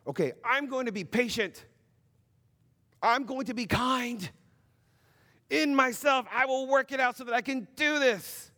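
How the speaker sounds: mock determined self-talk. Parent psyching themselves up to tutor their kid, strained and high. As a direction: talk yourself into patience through clenched effort.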